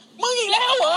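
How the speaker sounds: high exasperated cry. Retold or imitated. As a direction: squeal it in disbelief. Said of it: "ไอ้เหี้ยพี่เท่งบอก".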